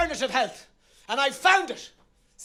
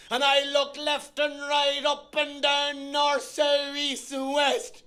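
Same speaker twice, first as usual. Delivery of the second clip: slow deliberate search; slower than usual. Retold or imitated. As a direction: drag each direction out slowly. Slow search across Soho.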